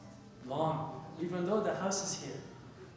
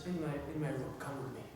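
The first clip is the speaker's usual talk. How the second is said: low, soft voice of a sheikh; understated, hushed authority; calm summons; 8 semitones lower, 10 dB quieter than usual. Retold or imitated. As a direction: quietly call the student aside. Sheikh calmly summons angry student.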